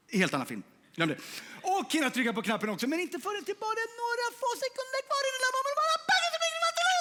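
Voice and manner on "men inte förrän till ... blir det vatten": high, fast build to a bang; movie trailer frenzy, pitch soaring; hammed-up action climax. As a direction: race the countdown to the explosion.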